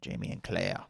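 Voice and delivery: put-on a weak stab at a Scottish accent. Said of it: "That's my Scottish accent".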